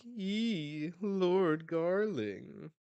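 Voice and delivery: high, loud, drawn-out squeal; hammed-up adoring girls' cheer; fangirls. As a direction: swoon over your idol. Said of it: "Celestial Dragon Girls are like".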